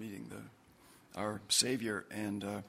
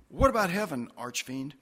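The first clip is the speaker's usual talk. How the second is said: louder, higher start dropping onto the nickname; cheekily personal question with falling finish; familiar, teasing address; 4 semitones higher, 7 dB louder than usual. Acted. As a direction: ask boldly, then tack on the nickname. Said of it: "okay to get a little personal".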